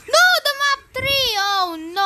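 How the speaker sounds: sudden high, loud cry of alarm; alarmed outburst. Real, not acted. Gamer spotting a mistake mid-build, high alarmed cry. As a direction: blurt it out as the mistake hits.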